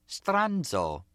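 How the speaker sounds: lilting, high-pitched mock Italian; affected Italian accent. A narrator's reading. Gloria needles Nicky with an insult, affected Italian accent. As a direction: put on an Italian accent with a lilt. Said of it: "affecting an Italian accent, getting a lilt in her voice".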